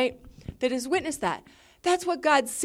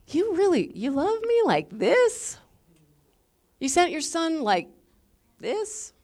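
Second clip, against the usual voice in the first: higher, incredulous wonder; humbled amazement, questions lifting high; awed disbelief; 4 semitones higher than usual. Acted. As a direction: ask God in stunned, halting disbelief. Awed believer addressing God at bottom.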